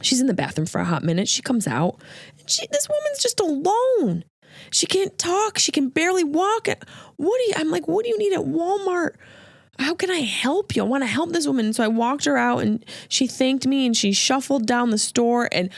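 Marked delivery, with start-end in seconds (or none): 2.4-11.7 emotional high voice. Acted.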